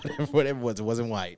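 the giggle spills over; laughing loudly. Real, not acted. Cracking up over the sheep's colour, laughing loudly. Still joking about the sheep.

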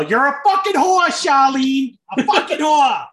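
high, loud imitated movie yell; yelled movie quote. Wrestler re-enacting what teammates yelled at each other, full yell. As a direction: holler the insult like a rowdy movie quote. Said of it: "we would just yell".

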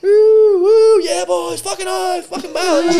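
very high, strained mock whoop; yelling hooligan. Rider hollering to the boys behind, imitated high yell. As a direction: whoop it like an overexcited drunk rider. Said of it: "he was just yelling".

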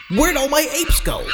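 high, lost squeaky wail of a robbed collector; bewildered hacked owner. Acted. Hacked ape holder to nobody, high bewildered wail. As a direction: whine it like someone whose apes just vanished.

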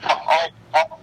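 very high cartoonish voice; high put-on voice. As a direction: squeak it up high. Put-on voice stammer.

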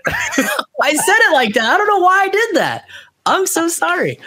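real giggly embarrassed apology; laughing while talking. Real, not acted. Laughing at his own slip.

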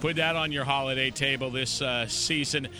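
upbeat announcer, pitch jumping up on key lines; radio sponsor read. Real, not acted. Host reading holiday sponsor spots and thanks, announcer style. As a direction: punch the product names like a local radio host.

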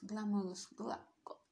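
breathy whispered fumble. Real, not acted. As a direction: whisper the stumble under your breath. Whispered fumble after mistake.

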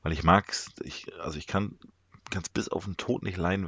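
ordinary delivery

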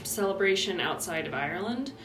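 ordinary talk, nothing marked

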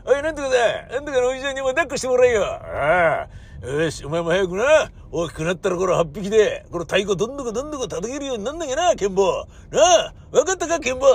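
put-on high, hearty doting old-timer; festival old man doting on kid. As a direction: pat the kid on the head with your voice.

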